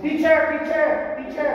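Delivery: high, loud game call. Pretend. Teacher calls out a word in class game, high loud call.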